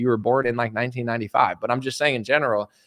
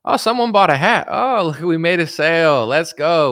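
higher, louder little cheer over a sale; excited; 5 semitones higher, 5 dB louder than usual. Real, not acted. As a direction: celebrate the first sale out loud. Celebrates a merch sale live.

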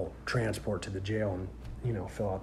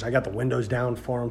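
usual speaking voice

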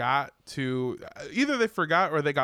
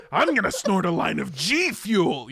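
loud, higher-pitched mock fan; hammed-up boast of an overexcited fan; hyped fan; 4 semitones higher, 5 dB louder than usual. Fan hyped on free G Fuel, loud mock bragging. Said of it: "they're hyped".

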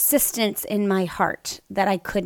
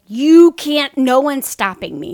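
louder and higher, refusing to be stopped; a mother who won't be held back, loud; defiant retort; 4 semitones higher, 9 dB louder than usual. Retold or imitated. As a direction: shut the husband down firmly. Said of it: "And I was like".

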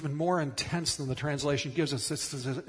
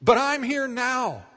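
earnest assurance; 7 semitones higher, 7 dB louder than usual. Retold or imitated. Jesus telling Martha he is present now, fervent. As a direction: insist you are here now. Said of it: "is saying".

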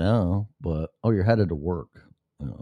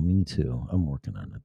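usual speaking voice

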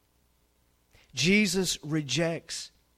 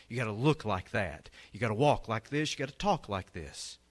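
ordinary talk

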